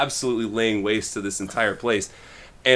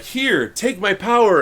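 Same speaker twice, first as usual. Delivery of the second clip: urgent offer; 9 semitones higher than usual. Retold or imitated. Rowan gives Will her power.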